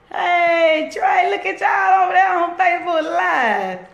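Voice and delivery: loud, high holler of greeting; excited call-out. Real, not acted. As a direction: shout it out high to someone you spotted. Calls out to viewers joining.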